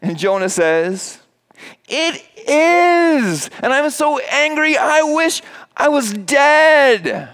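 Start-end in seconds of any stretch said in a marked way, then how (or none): 1.9-7.3 put-on much higher, whiny child's voice, slow at the end; whiny little kid. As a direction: whine it like a kid throwing a tantrum. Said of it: "sounds a little bit like a whiny little kid".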